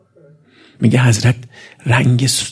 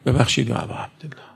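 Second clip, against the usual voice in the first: hushed apology; 7 dB quieter than usual. Real, not acted. Begs the Imam's pardon aside.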